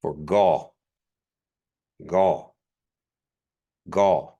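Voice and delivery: gaw with a plain voiced g; regular G. Shown as a demonstration. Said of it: "just like a regular G".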